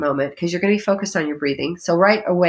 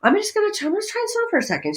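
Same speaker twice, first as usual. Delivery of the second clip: much higher, fast, light and curious; playful high voice; 12 semitones higher, much faster than usual. Pretend. Trying the breath on for fun, high quick playful voice. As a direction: try it on like a game, high and quick.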